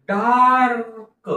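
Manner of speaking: Dark stretched out slowly, sound by sound; slow blending. Shown as a demonstration. Teacher blending a word slowly for pupils. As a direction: draw out dark for the class. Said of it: "हळूहळू सावकाश म्हणून दाखवत आहे".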